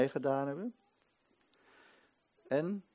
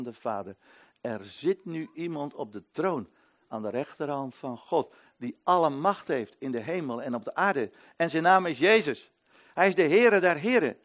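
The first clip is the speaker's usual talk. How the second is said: loud, higher proclamation of Christ's power; preacher proclaiming Jesus as king, loud and soaring; preaching at full force; 3 semitones higher, 9 dB louder than usual. Acted. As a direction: ring it out like a herald.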